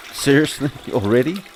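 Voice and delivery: high, loud "Seriously?" in disbelief; can't believe this is happening already; surprised. Real, not acted. Player reacting to the game's sudden collapse, loud and disbelieving.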